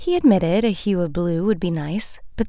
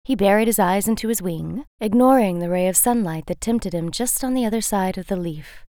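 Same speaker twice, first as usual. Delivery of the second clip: usual speaking voice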